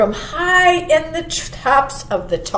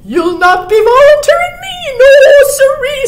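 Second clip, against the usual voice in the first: high, loud, hurried refusal; scared rabbit; 10 dB louder, faster than usual. A narrator's reading. Scared rabbit refusing to volunteer, high and hurried. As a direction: squeal it out like a frightened rabbit. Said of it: "scared rabbit".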